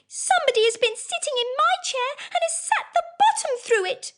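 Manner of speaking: very high, tiny squeaky voice; little wee bear voice. Acted. Wee bear wails his chair is broken, very high. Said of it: "in his little wee voice".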